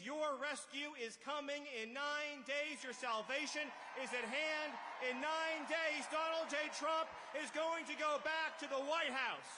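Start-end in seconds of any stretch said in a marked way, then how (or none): 2.9-9.5 loud building rally shout; rally speech. Real, not acted. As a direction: rise and hammer it home.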